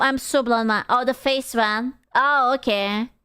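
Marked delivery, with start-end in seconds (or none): none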